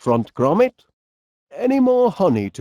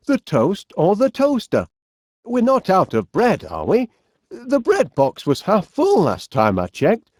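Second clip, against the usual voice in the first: normal talking voice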